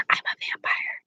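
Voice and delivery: whispered, creepy vampire hush; playful spooky whisper. Pretend. Joking vampire claim, whispered.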